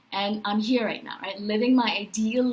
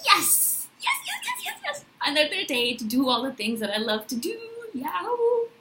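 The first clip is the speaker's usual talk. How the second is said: squealing, soaring yeses with a laugh, much higher; excited waking up; 8 semitones higher than usual. Acted. Waking up each morning thrilled about her day, squealing yes with a laugh. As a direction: burst out with giddy joy at a new day. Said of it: "This is literally how I wake up every morning".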